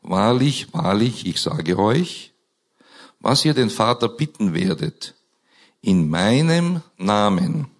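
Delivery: ordinary talk, nothing marked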